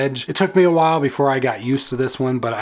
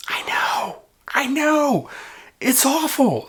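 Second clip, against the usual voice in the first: high, hammed-up wail of shame; playful high-pitched lament; mock anguish; 12 semitones higher, slower than usual. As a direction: confess it in comic despair. Mock confession of a shameful habit.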